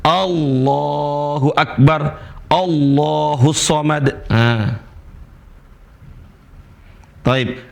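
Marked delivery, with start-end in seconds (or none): 0.0-4.3 slow "Allahu Akbar", the A sounded in full; initial hamza sounded. Shown as a demonstration. Teacher shows the A read at the start. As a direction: draw out Allahu with its opening A. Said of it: "Kalau di awal".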